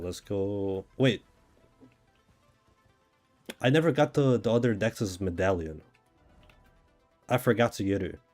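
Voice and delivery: usual speaking voice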